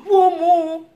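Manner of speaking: high, loud panicked wail; retold scream. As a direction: wail it like you fear the crash. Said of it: "j'ai crié".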